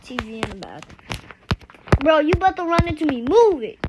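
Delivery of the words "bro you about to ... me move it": loud, fast, higher warning; real impatient shout; annoyed yell. Real, not acted. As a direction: yell at a player to move. Telling player to get out of way.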